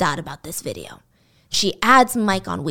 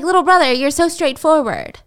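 higher, louder coy tease; flirty teasing; 6 semitones higher, 4 dB louder than usual. Retold or imitated. Wanda teasing Mike for being so forward, in a coy voice. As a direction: tease him coyly, up high.

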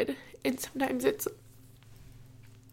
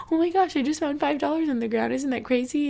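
excited; 5 semitones higher, 4 dB louder than usual. Retold or imitated. Excited call to her dad.

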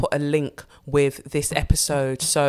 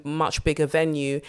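ordinary talk, nothing marked

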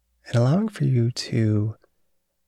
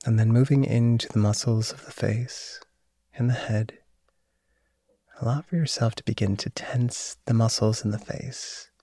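guided meditation. Acted. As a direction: guide them into calm and sleep. Leads a body relaxation.

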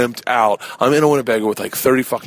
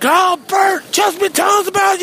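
put-on much higher shouted greeting from a crowd of girls; excited party girls.

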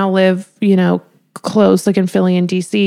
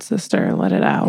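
whispered urging; 5 dB quieter than usual. Real, not acted. Urging friend, playful.

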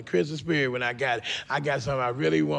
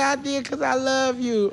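little niece; 9 semitones higher than usual. Acted. Little niece to her uncle at Christmas, childlike and hopeful. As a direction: pitch it up like a small child. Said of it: "little niece looked at me and said".

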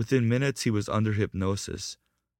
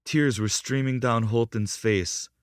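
plain reading voice

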